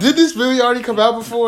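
high, loud, insistent question; exaggerated loud incredulity; retold disbelief. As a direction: push the baffled question over the interruption. Insists over interruption, retold disbelief.

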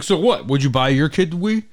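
mock angry dad. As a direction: imitate a dad snapping defensively. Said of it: "like, screaming at his kid".

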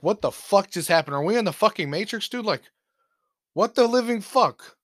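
to his friend as the cop waves them off, in disbelief; stunned disbelief. Retold or imitated. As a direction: blurt out total disbelief.

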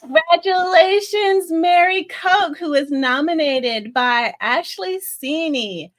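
high, loud winner reveal; hyped giveaway announcement. Real, not acted. Wheel spin reveals the winner.

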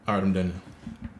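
ordinary talk

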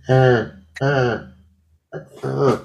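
high cawing crow. Retold or imitated. Crow on the tree, cawing at the man. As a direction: caw like an insistent crow. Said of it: "I love your sound effects".

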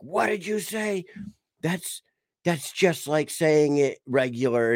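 high-pitched shocked mom voice; high, indignant mock reprimand; imitating scolding wife. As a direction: play a mother catching the kid swearing. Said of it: "and she's like".

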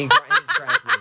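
very high, loud squeal; panicked shriek. Real, not acted. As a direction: shriek as you get grabbed. Grabbed in game.

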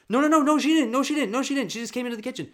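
very high, very loud desperate no's; shrieking denial. Retold or imitated. Mom to her kids, shrieking in panicked denial. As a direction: scream it away as if saying it undoes it.

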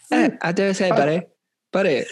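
high, punchy cartoon Canadian accent; South Park Canadian accent. Showing off the South Park Canadian voice to friends, hammed-up. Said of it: "South Park Canadian".

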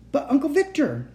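high, pleading outcry; distressed protest. A narrator's reading. Tied-up child objects to the uncle, pleading. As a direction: protest with the pitch shot up.

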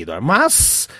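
ordinary talk, nothing marked

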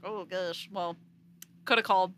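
put-on fast, loud Southern accent chuckling at the end. Imitating a Southern reviewer, fast, loud Southern accent. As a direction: rattle it off in a hammy Southern accent. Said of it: "I like your accent".

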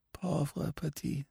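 soft, slow, pitying French murmur; soft musing. A narrator's reading. Old priest pities the dying Countess, soft and slow. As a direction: breathe it out slowly in pity. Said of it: "thought the priest".

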